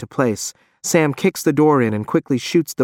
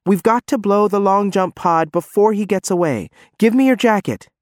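urgent shouting; 9 semitones higher than usual. Acted. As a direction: shout it, no time left. Urgent orders after Sam is shot.

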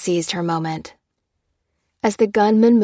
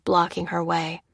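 usual speaking voice

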